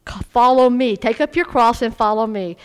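loud, commanding call; voice of the Lord. Acted. Preacher voicing the Lord's call to follow, loud command. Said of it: "The Lord said".

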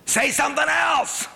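put-on high squeaky parent coaxing baby. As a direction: pitch way up to coax the toddler. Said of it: "Do you ever go".

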